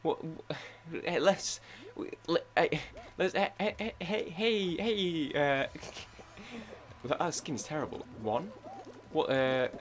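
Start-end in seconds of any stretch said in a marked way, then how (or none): 0.0-5.7 put-on British accent. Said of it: "my British accent".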